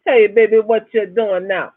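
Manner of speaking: loud, high Bahamian come-on; imitated Bahamian flirt, loud and high; Bahamian accent. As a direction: chat her up in a Bahamian accent. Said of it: "with that Bahamian accent".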